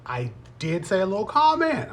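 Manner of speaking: ordinary delivery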